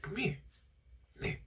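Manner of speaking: very soft, furtive summons; secretive beckoning. Retold or imitated. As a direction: beckon them over under your breath. Janitor secretly beckoning in story.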